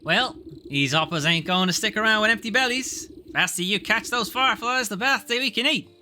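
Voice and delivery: put-on high urchin voice with folksy accent; pushy ragamuffin boy. Boy urging the lady to catch fireflies, pushy. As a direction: hurry them along as a high-voiced frog-catching boy.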